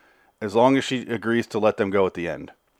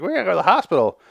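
higher, urgent worried woman; 9 semitones higher, 4 dB louder, faster than usual. Retold or imitated. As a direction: urgently press him to leave. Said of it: "She's like".

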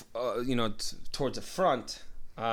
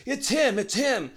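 high, urgent identifying cry; retold calling out; 12 semitones higher, 4 dB louder than usual. Woman recognizing the suspect's tattoo, high urgent call. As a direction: call it out to the other officers.

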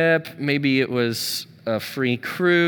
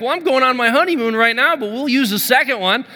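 excited; 7 semitones higher than usual. Retold or imitated. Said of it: "I'm getting excited".

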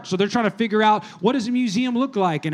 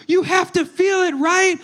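higher and louder, pressing; passionate insistence; 7 semitones higher, 3 dB louder, slower than usual. Retold or imitated. Connoisseur presses the stranger to feel the painting, higher and insistent.

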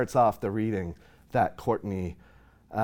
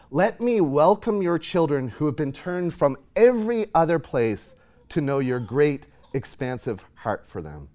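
fervent prayer; 4 semitones higher, 6 dB louder than usual. Retold or imitated. Reading journal prayer aloud fervently.

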